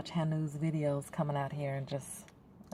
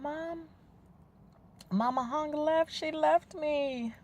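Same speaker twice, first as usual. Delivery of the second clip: put-on high, tearful child's plea, slowing at the end; retold crying plea; 11 semitones higher, slower than usual. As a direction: relive a child crying to her mom. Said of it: "I was crying to my mom".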